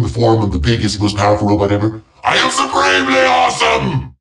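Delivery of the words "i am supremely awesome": high, fast triumphant proclamation; boastful shout. Acted. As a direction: crow it like a self-adoring tyrant. Villain boasts at climax.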